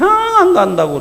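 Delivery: high, loud flat-out no; stubborn refusal. Acted. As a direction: dig in and refuse outright. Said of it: "그러죠".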